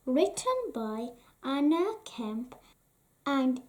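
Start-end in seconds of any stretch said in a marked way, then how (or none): none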